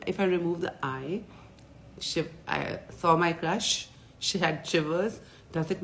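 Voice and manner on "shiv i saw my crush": ordinary talk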